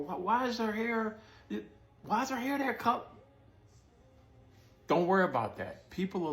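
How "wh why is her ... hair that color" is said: high mocking gossip voice; judgy onlooker voice. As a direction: sneer like a nosy stranger judging looks. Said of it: "they're looking at you and just like".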